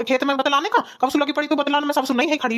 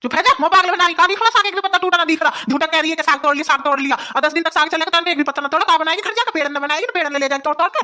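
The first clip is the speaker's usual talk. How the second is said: angry shouting; 4 semitones higher than usual. Acted. Old woman scolding bride for chatting instead of picking greens, angry shout. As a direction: yell at her for not picking anything. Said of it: "अरे मैं चिल्ला क्यों रही हूँ".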